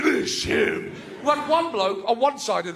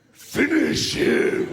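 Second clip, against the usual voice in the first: slow, rasping announcer boom; slow menacing game announcer; 3 semitones higher, much slower than usual. Retold or imitated. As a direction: draw the words out like the arcade voice.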